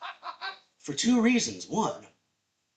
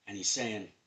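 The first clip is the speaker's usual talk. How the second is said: ordinary delivery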